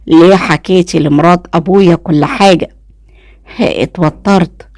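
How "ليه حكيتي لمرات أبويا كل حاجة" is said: plain reading voice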